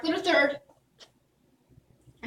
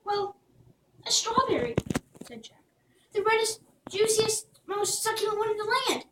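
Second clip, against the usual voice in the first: Jack, shy; 7 semitones higher than usual. Acted. Jack to the guard, higher and shy. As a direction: offer it hopefully.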